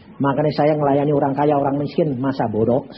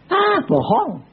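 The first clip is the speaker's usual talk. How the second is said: high, mocking brush-off; scornful scoff; 6 semitones higher, slower than usual. Rich man scoffing that pastors only want money, mocking. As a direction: sneer at greedy pastors.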